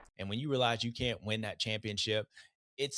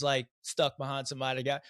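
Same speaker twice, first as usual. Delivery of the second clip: normal talking voice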